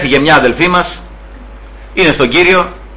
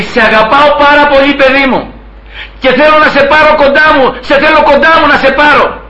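much higher, sustained declaration of love; voicing the Lord's loving call; 8 semitones higher than usual. Preacher voices the Lord calling his child home, loving and insistent. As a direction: quote a divine call in a high, insistent chant-like line.

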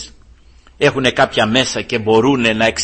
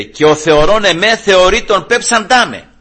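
higher, ringing scripture quote; raised proclamation; 6 semitones higher, 5 dB louder than usual. Acted. Preacher repeats the verse Jesus cried out, raised proclamation. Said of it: "φώναξε ο Κύριος δυνατά".

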